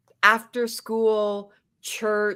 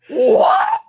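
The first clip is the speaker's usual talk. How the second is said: very high, loud shocked shriek of what; screeching what in disbelief; shocked shout; 9 dB louder than usual. Retold or imitated. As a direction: mimic a stunned shriek. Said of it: "she goes".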